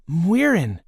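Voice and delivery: startled breathless recognition; gasped. A narrator's reading. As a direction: gasp out her name in surprise. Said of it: "Micah gasped".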